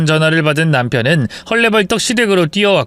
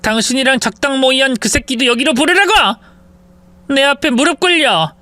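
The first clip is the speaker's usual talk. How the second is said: furious daughter-in-law demanding her husband be brought; raised, commanding demand; angry wife; 7 semitones higher than usual. Acted. Wife confronts mother-in-law.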